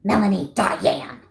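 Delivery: a hint of a mom calling her child in trouble; mom's scolding call. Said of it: "let's see if I can do my mom's imitation".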